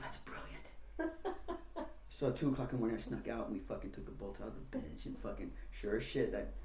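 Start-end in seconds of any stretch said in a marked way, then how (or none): 0.0-1.1 slow whispered praise; conspiratorial whisper; hushed approval. Retold or imitated. Friend approves prank quietly.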